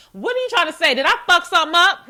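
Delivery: loud, high, indignant demand; exasperated comeback. Acted. Worker confronting a vague manager, loud exasperated comeback. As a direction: burst out at the hinting boss. Acted reply to hinting managers.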